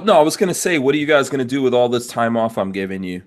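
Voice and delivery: ordinary talk, nothing marked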